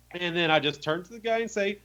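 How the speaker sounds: ordinary talk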